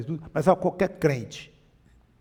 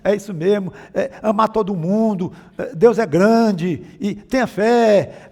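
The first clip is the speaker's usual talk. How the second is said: higher, louder mock of pious platitudes; mocking empty chatter; 4 semitones higher, 6 dB louder than usual. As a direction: rattle off pieties as nagging blah-blah.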